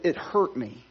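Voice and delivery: normal talking voice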